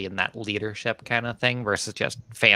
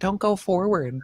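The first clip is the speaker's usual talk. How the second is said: higher-pitched mock plea to players; playful coaching from the couch; mock sideline orders; 6 semitones higher than usual. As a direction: pretend to call a player back.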